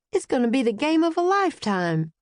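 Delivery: an easygoing Georgia man selling the big game; Southern accent. A narrator's reading. Southern guard tells the kids about the game, Southern accent. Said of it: "He spoke with a Southern accent".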